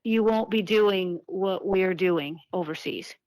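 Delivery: ordinary talk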